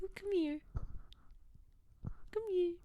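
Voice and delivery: a mild high coaxing call, like to a puppy; calling a dog. Retold or imitated. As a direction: coax a pet over, lightly. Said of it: "Like a dog".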